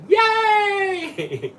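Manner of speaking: high, loud shouted cheer; group cheer. Retold or imitated. Mimicking her Tupperware team cheering, mock shout. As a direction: shout it like a delighted crowd. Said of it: "And they all go".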